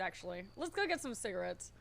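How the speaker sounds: ordinary delivery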